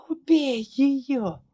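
high, slow rasping plea; hoarse plea. Acted. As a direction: beg hoarsely, drawing it out. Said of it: "прохрипела Ларри".

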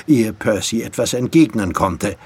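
usual speaking voice

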